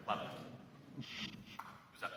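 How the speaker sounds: normal talking voice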